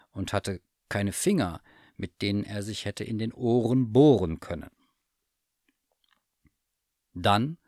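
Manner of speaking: plain reading voice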